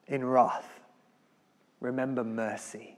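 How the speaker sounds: the preacher dwelling on a loved verse; soft reading. Pretend. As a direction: savour the words gently. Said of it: "This is a lovely verse".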